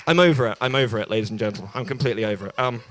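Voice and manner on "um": ordinary talk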